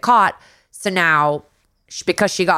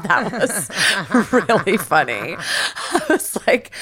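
laughing; slower than usual. Real, not acted. Laughing at the funny line.

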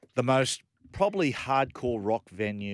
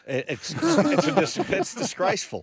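mock outrage; 10 semitones higher, 7 dB louder than usual. Real, not acted. Mock outrage at weed smell.

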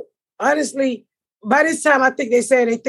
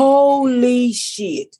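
slow, drawn-out disbelief; retold stunned reaction; much slower than usual. Watching the second plane hit, slow stunned disbelief. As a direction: stretch each syllable in shock.

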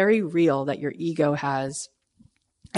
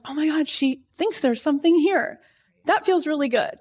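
bright excited rush, pitched up high; thrilled; 9 semitones higher than usual. Retold or imitated. Author retelling her thrill after meeting an agent, excited. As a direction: gush like the news just landed.

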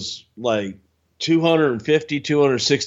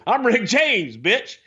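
higher swaggering [name] line; [name] catchphrase; 10 semitones higher than usual. Retold or imitated. [name] answering the woman, cocky and higher. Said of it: "He goes".

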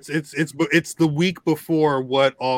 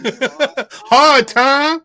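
dramatic yell; 10 semitones higher, 9 dB louder than usual. Pretend. Laughing mock dramatic.

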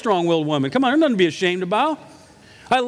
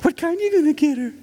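mock cheerful young cashier; perky girl; 9 semitones higher than usual. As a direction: play a gushing teenage clerk. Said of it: "And she goes".

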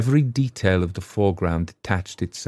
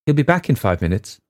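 higher, anxious rush; frightened girl; 6 semitones higher, 3 dB louder than usual. A narrator's reading. Girl warning Wyant of her father, anxious. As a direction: warn him with fear.

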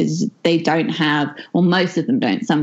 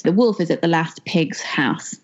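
ordinary delivery

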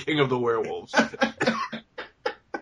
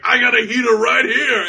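mock sleazy shout; 6 semitones higher, 4 dB louder than usual. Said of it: "And he's like".

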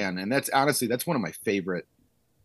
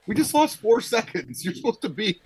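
mock indignant yell; 12 semitones higher than usual. Yelling up at clock booth.

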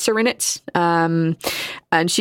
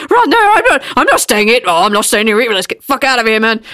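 panicked yelling; 3 semitones higher, 6 dB louder than usual. Acted. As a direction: yell it while fleeing in terror. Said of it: "like, yelling".

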